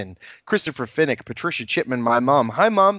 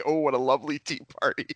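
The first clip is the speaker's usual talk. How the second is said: quick light mock dainty voice; a hint of a prissy guest; imitating prim quote; 4 dB quieter, faster than usual.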